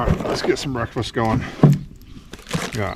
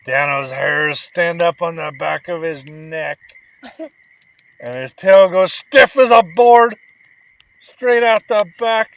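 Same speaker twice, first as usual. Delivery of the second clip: put-on telling a bedtime story to a sleepy child; bedtime story narration; 4 dB louder than usual. A narrator's reading. Dad reading a bedtime story to his son, animated storytelling.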